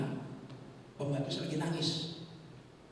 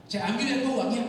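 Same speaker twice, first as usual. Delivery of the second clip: loud, high-pitched call; eager claim; 8 semitones higher, 8 dB louder than usual. Acted. Other man grabs the money, retold. (seruan lantang bernada tinggi)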